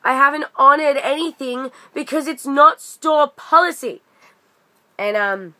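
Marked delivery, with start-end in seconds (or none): 0.1-4.0 much higher and louder, emphatic; mock exasperated manager; retold firm refusal. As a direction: stand your ground and shut her down. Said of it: "he's like".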